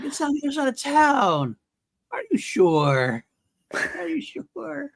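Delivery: high-pitched, hammed-up movie line; lightly put-on character voice, drawn out at the end; mock movie quote. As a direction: stretch the question out in a high mock voice. Acts out movie character's quoted lines.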